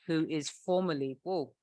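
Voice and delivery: ordinary talk, nothing marked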